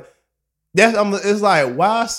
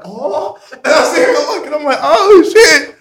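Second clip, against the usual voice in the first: high, loud, amused exclamations; laughing mock shock; 11 semitones higher, 10 dB louder than usual. Peeking over his blanket at the slap, laughing amazement. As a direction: laugh through the astonished retelling.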